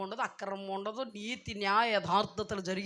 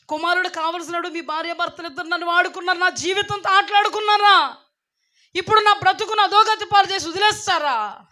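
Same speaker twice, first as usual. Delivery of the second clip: Hagar's pleading protest; 8 dB louder than usual. Acted.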